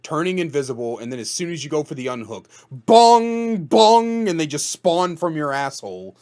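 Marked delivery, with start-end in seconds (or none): 2.7-4.3 high, booming "bong" noises; imitated loud bongs. Gamer acting out a sudden ambush at the unhook, loud bongs.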